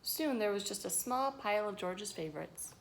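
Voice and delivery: ordinary delivery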